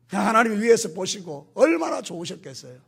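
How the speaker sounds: normal talking voice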